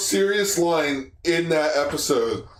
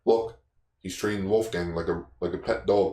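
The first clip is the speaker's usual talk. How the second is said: sincere line read; 9 semitones lower, 4 dB quieter than usual. Retold or imitated. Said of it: "the most real line read".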